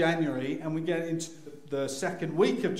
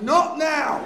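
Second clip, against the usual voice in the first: high, loud outburst; hammed-up frantic refusal; panicked protest; 11 semitones higher, 9 dB louder than usual. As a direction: yell back in mock panic. Panicked father rejecting the poster's advice.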